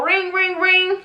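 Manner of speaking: playful mock ring-ring of a phone; phone ring imitation. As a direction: imitate a phone ringing in your voice. Phone ringing in story.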